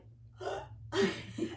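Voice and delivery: sharp unvoiced intake of breath; astonished gasp. Husband hearing his demanded sign, a sharp gasp. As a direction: gasp as if the sign just came true.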